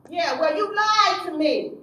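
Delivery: loud, high, furious; angry accusation. Retold or imitated. Counselor confronting the youth about his lie, loud and furious. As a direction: blast him for lying.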